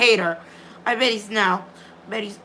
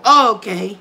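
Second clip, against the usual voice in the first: ordinary delivery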